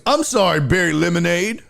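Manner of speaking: high-pitched fake sorry, rising at the end; mock apology. Heckler answering comic's calm down, mock-apologetic. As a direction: imitate a heckler pretending to apologise.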